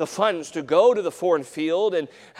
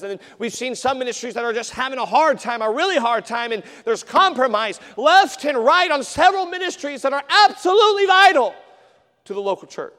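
loud, high, driving sermon delivery; fiery preacher calling the church to act; preaching at full force; 10 semitones higher, 10 dB louder than usual. Acted. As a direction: hammer the point home to the congregation. Preaching builds.

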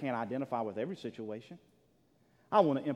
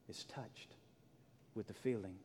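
whispered, much softer; whispered emphasis; 4 semitones lower, 12 dB quieter than usual. Acted. Pastor whispering comfort to church. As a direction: hush it to a breath.

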